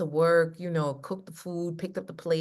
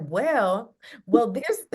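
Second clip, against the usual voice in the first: higher and louder, laughing through the words; laughing sheepishly; 6 semitones higher, 7 dB louder, much slower than usual. Real, not acted. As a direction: confess the long list while cracking up. She laughs telling therapist list.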